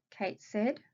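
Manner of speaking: ordinary delivery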